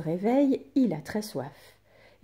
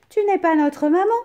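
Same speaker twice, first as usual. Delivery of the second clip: put-on high, loud kids' voices; little goats refusing; goat kids answering; 10 semitones higher, 9 dB louder, faster than usual. A narrator's reading. Kids refusing the wolf at the door, high and loud. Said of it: "répondent les chevreaux".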